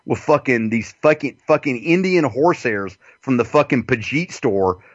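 usual speaking voice

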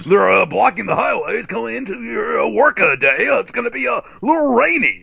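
mock drive-time radio host; mock radio traffic report. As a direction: play the morning radio traffic guy.

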